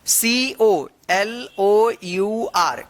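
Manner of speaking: ordinary talk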